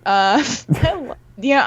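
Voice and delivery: ordinary talk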